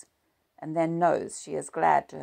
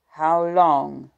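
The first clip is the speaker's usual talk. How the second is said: 1 demanding; 12 dB louder than usual. A narrator's reading. Husband demands answer.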